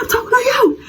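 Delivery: high laughing challenge; mock yell. Confronting the rival girl in the hallway, high laughing yell. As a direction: shout it back half laughing.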